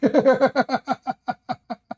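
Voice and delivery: high-pitched, tailing-off giggles; high helpless laughter. Real, not acted. Host at the opponent's exit, helpless laughter. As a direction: laugh until the breath runs out.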